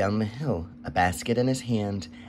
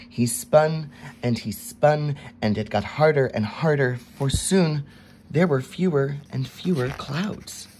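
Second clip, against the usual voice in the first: building urgency; 6 semitones higher, 5 dB louder than usual. A narrator's reading. Narration builds with the struggle.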